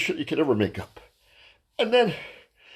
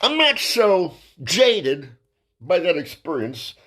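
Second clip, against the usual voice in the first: slow emphasis; 3 semitones higher, slower than usual. Real, not acted. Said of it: "deliberate punch setup".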